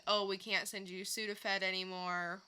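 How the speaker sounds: ordinary talk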